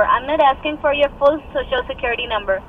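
ordinary delivery

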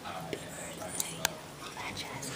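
hushed whisper. Real, not acted. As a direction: whisper so he won't hear. Sneaking up on him.

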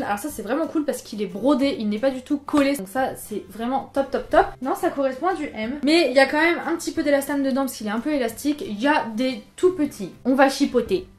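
usual speaking voice

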